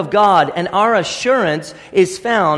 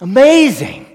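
awed exclamation; 4 semitones higher, 4 dB louder than usual. Real, not acted. Preacher exclaims after quote.

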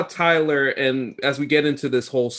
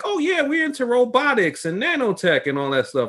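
mock Tyler; 10 semitones higher than usual. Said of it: "and Tyler's saying".

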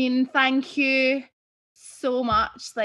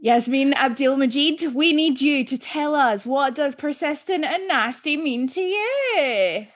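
announcer voice; 5 semitones higher, 4 dB louder, slower than usual. Acted. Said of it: "host's big closing question".

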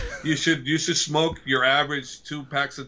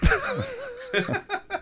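squealing high laugh; high burst of laughter. Real, not acted. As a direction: let out a shrieking laugh. Big laugh at joke.

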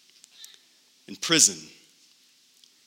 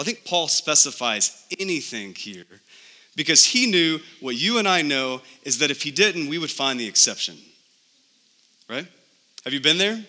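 usual speaking voice

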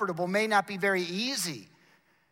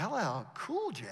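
soft, swooping echo of the name; quiet, incredulous question; hushed reaction; 8 dB quieter than usual. Retold or imitated. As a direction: say it under your breath in mild disbelief. His startled reaction to the call.

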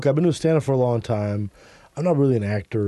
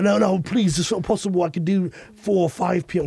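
higher, pleading British voice; British accent; 4 semitones higher than usual. Acted. Guest haggling for a later checkout, pleading British accent. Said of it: "we use our British accents".

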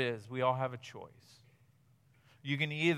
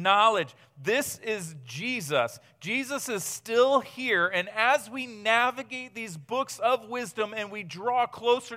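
loud, high, impassioned sermon; fervent preaching; 7 semitones higher, 9 dB louder than usual. Acted. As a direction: preach it with rising fire. Preaching at full force.

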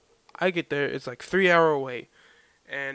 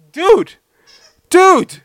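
much higher and louder; exasperated yelp; 13 semitones higher, 10 dB louder than usual. Real, not acted. At his dog mid-recording, high loud exasperated yelp. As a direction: squeal the word in disbelief.

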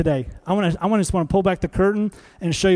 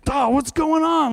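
mock bewildered cry; 9 semitones higher than usual. Voicing shampoo prank victim.

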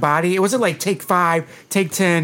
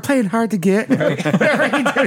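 higher, slow words through laughter; laughing; 6 semitones higher, slower than usual. Real, not acted. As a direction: crack up while telling it. Cracking up retelling the film scene.

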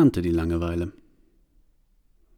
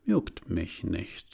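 soft, slow, couldn't-care-less; bored dismissal; 3 semitones lower, 8 dB quieter, much slower than usual. Acted. Boredom shrugs off the Allegory's arrival. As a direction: shrug it off lazily.

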